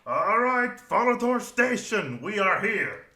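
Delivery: high, loud station call; conductor shouting. Acted. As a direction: call out the arrival to the whole train. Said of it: "a dwarf shouting in dwarvish tongue".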